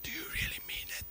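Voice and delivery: whispered, fast and unvoiced; desperate man whispering to the street preacher; hushed question. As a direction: ask it in an urgent whisper. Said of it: "he said".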